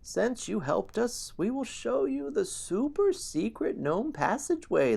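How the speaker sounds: high, slow gnome voice; gnome elder voice. Acted. Gnome elder addressing the hero, high and slow. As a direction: speak as a kindly tiny elder. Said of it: "A gnome elder quiets the crowd and says".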